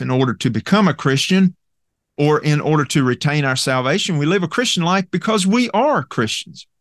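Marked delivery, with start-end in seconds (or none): 5.5-6.7 ARE leaned on as the key word; emphatic ARE, higher than the words around it; stress on ARE. Shown as a demonstration. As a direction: punch "are" in the phrase. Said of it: "Emphasis original".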